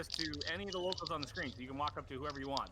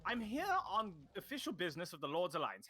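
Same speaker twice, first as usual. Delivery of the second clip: higher-pitched, proper gentleman envoy; formal Sildar; 4 semitones higher than usual. Acted. Sildar addresses the barkeep on official business, formal and high. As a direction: put on a chummy official's voice, pitched up. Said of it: "turns to, uh, the, uh, the barkeep and says".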